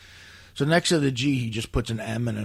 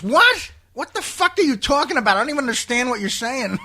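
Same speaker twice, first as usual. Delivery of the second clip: much higher, louder enraged yell; furious outburst; 13 semitones higher, 7 dB louder than usual. Retold or imitated. Patient yelling back at therapist, mock furious. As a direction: explode at the therapist in disbelief. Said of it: "he wanted to punch this woman".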